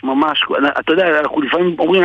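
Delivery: normal talking voice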